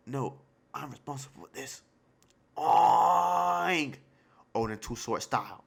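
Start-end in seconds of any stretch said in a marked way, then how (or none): 2.6-4.0 pig squeal. Retold or imitated. Reactor mimics the boar.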